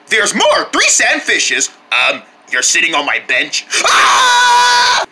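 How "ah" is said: long, very high scream; startled scream. Acted. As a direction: shriek in cartoonish alarm.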